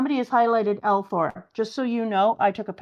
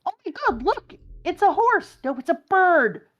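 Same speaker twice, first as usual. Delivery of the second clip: much higher, excited squeal; surprised character; 11 semitones higher, 5 dB louder than usual. Acted. Crewmate spotting the bird, high surprised squeal. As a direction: gasp in high-pitched amazement.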